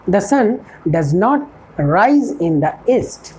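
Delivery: each word spaced out for learners; a teacher dictating an English model sentence, slow and clear; slow dictation. Shown as a demonstration. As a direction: spell it out slowly like a teacher at the board.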